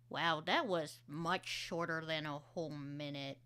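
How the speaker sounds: normal talking voice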